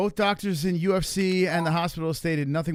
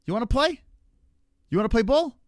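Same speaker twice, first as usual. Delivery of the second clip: high, louder goading questions; taunting challenge; 8 semitones higher, 5 dB louder than usual. Real, not acted. Player goading opponent to call, high hyped taunt. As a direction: egg him on, hyped.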